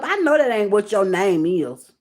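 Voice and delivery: usual speaking voice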